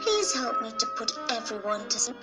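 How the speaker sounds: usual speaking voice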